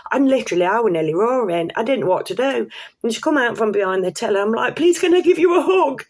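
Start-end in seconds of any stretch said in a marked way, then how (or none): 4.8-6.1 very high and louder, laughing through it; laughing, tearful gratitude; overjoyed. Retold or imitated. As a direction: burst out with a giddy plea. Overwhelmed asking cashier for hug.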